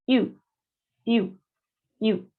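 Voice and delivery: "Iu" as one French U, an I through rounded lips; short rounded "iu", like French U. Shown as a demonstration. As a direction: shape an O and say I for "iu". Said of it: "Y decimos la letra I".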